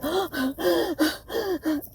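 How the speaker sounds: high gasping intake of breath; sharp gasp. Retold or imitated. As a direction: gasp like you can't breathe. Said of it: "I was like".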